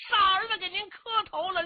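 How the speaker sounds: high and loud, 傻 punched as he kowtows; a brash fellow bowing with gusto, voice up high; boisterous high-pitched hero greeting his elder; Cheng Yaojin's voice. Acted.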